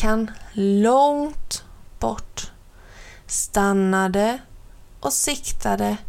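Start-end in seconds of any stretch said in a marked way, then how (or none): none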